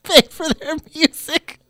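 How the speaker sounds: very high, strained attempt to finish; fighting off the giggles; squeaky from laughing. Real, not acted. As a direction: squeeze it out high while cracking up. Finishing sentence through suppressed laughter.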